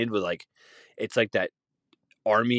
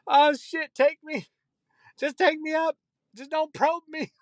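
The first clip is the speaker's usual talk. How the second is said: panicked blind guy. Retold or imitated. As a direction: beg the aliens like a terrified man.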